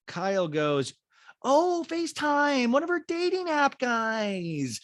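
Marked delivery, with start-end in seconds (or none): 1.4-4.8 high, drawn-out teasing call; mock excited friend, pitched way up; teasing squeal. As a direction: tease in a high, slow singsong. Acting out Kyle teasing over FaceTime.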